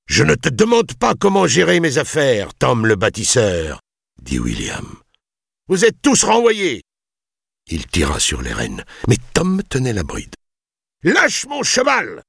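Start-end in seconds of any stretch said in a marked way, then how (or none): 0.1-3.8 high, indignant lordly voice; haughty rebuke. A narrator's reading. Lord rebukes the builder. 5.7-6.8 high, raised imperious cry; angry dismissal. A narrator's reading. Lord sacks everyone. 11.0-12.2 high, slow, threatening order; menacing cry. A narrator's reading. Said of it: "cria William d'un ton menaçant".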